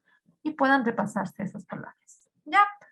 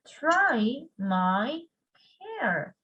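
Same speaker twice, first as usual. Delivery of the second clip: ordinary delivery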